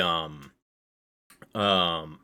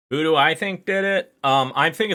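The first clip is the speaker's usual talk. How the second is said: excited; 11 semitones higher, 8 dB louder than usual. Real, not acted. Rhetorical question, hyped.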